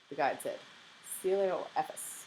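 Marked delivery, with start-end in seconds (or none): 1.2-2.2 slow, drawn-out "Coelioephus"; careful, slow pronunciation of "Coelioephus"; said slowly. A narrator's reading. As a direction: stretch "Coelioephus" out for the class, pitched higher. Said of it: "she said again slowly".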